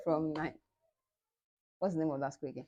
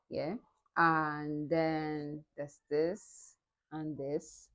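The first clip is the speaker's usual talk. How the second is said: ordinary delivery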